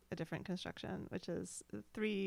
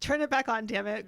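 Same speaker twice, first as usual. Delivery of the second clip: loud plea; 3 semitones higher, 11 dB louder than usual. Real, not acted. Host begging listeners to keep the podcast on, loud. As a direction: call out, turn it back on. Urging listeners not to quit.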